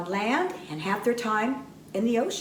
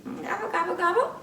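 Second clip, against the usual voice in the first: higher gobbling turkey farewell; turkey gobble goodbye; 11 semitones higher, faster than usual. Acted. Turkey puppet saying goodbye to the children with gobbles. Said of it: "Say goodbye".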